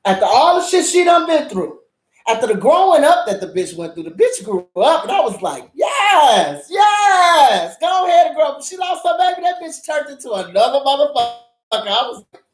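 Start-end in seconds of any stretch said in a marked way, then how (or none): 5.7-8.6 higher, louder, egging her on; root for her like a fan at the screen; mock cheer, pitched up and loud; excited cheering. Cheering on the lead actress.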